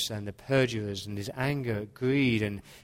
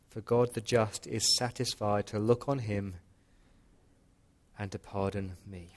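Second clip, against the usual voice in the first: prayer. Acted. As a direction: pray it gently and reverently. Closing prayer.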